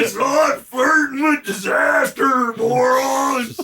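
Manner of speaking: high strained troll shout. Acted. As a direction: wail it like a furious commenter. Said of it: "Your troll voice is fucking perfect".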